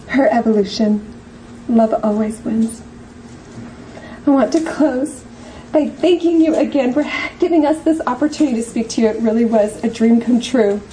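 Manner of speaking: ordinary talk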